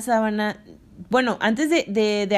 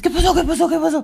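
high, loud, urgent repeated questions; alarmed questioning; 8 semitones higher, 6 dB louder than usual. Retold or imitated. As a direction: rush in asking what happened. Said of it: "como todo, todo oído".

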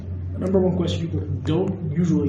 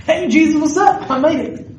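high, loud, thrilled arrival call; a joyful shout of hello; excited greeting; 10 semitones higher, 5 dB louder than usual. Pretend. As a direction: burst in like you just made it to heaven. Arriving in heaven, greeting Jesus.

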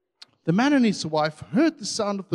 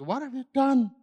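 higher, sharp accusing question; mock parent scolding; 6 semitones higher than usual. Parent walks in on kids who messed up, mock scolding. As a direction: imitate a parent catching the kids. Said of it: "some parents typically come into a room".

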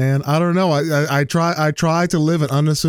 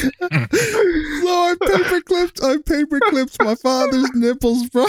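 words squeezed out through laughter; laughing; 14 semitones higher, slower than usual. Real, not acted. Telling friends the prank story, helpless with laughter. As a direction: barely get it out for laughing.

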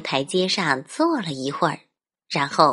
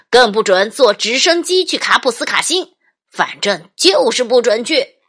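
louder and louder list of bans; stern dad; 3 semitones higher, 6 dB louder than usual. Acted. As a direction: build the ban to a loud end. Dad forbids everything.

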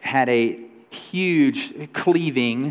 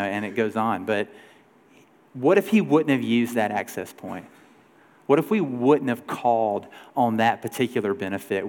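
ordinary talk

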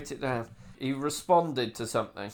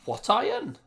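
put-on Welsh accent; 9 semitones higher than usual. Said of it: "maybe you could do that in a Welsh accent".

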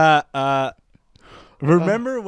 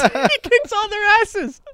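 laughing delight; 4 dB louder, slower than usual. Real, not acted. Laughing excitedly retelling movie.

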